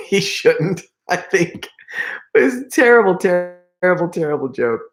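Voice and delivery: high-pitched, giggly judgement; amused laughing. Real, not acted. Storyteller judging his friend's prank, amused laughing. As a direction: let laughter push the words up high. Laughs over the terrible joke.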